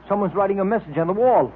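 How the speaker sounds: normal talking voice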